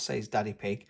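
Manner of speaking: usual speaking voice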